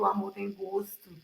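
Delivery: usual speaking voice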